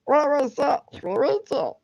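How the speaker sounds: very high, strained cartoon creature voice; trying for the cartoon meatball's squeal; Meatwad voice. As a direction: squeak it like a blob of meat. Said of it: "I'm trying to do that meat wad voice".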